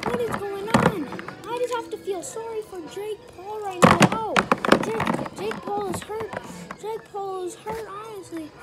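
excited ringside play-by-play; wrestling commentator. Acted. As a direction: call the match like a hyped announcer.

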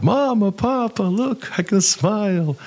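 high, thrilled call to mama and papa; excited calling out. Retold or imitated. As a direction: shout your joy up high like a child. Boy calls to his parents.

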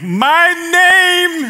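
an American speaking loudly in Mexico; talking loud to foreigners. Retold or imitated. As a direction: yell it like they're deaf. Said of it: "turn the volume up".

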